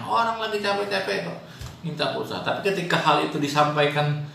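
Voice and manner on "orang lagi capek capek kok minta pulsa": high, grumbling father; mock the tired father's irritation; annoyed father. Tired father snapping at his child.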